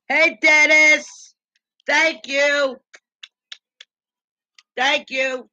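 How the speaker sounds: loud, high, drawn-out shout-out; calling out. Real, not acted. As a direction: hail a viewer with hearty thanks. Shout-out to a named viewer.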